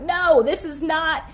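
high, loud panicked pleading; frantic "what are you doing" to husband; alarmed protest. Retold or imitated. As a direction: squeal it at your husband in alarm. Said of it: "I'm like".

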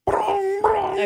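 high sung computer startup noise; computer chime imitation.

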